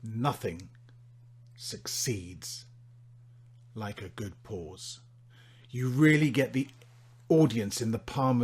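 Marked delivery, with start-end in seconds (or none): 0.0-5.0 a long pause before each word, fading softer; dramatic pauses. Shown as a demonstration. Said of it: "dramatic pauses are wonderful".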